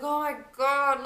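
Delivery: loud, high, slow anguished cry; an overwhelmed mother in labour crying out loud and high. Retold or imitated. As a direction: cry it out in pain. Reliving the pain of labour.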